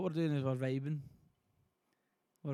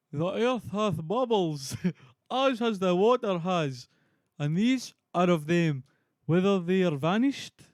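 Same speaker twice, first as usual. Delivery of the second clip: loud, higher theatrical Shakespearean voice; Banquo; 4 semitones higher, 7 dB louder, slower than usual. Acted. Streamer reading Macbeth to viewers as Banquo, loud and declamatory. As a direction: declaim it slowly and grandly, a little amused. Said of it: "Banquo mode activated".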